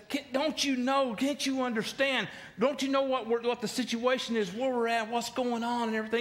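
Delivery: high-pitched, worked-up exasperation, imitating; aggravated panic. Panicking castaway confronting his relaxed companion, high and aggravated. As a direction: plead at him in mock frantic disbelief. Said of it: "he gets so aggravated with this old boy".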